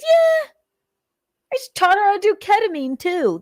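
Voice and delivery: mock him bragging casually; mocking imitation. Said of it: "That's what he said".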